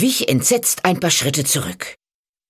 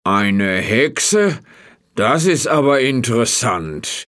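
lower, slow, wondering farmer voice; curious farmer; 5 semitones lower, slower than usual. A narrator's reading. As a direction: draw out the curiosity slowly.

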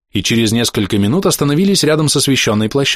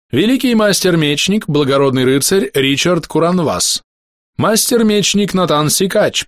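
town crier; 4 semitones higher than usual. Acted. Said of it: "хорошо поставленным голосом Герольда объявил".

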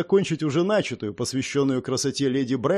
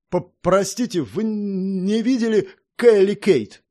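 drawn-out, louder worried stutter; anxious stammer; 5 semitones higher, 3 dB louder than usual. A narrator's reading. Orson interrupts to ask after a missing girl, stammering anxiously. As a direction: stumble over the words with worry. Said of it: "От волнения он заикался даже больше обычного".

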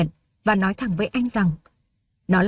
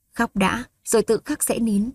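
ordinary talk

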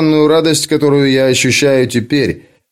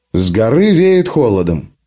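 ordinary delivery

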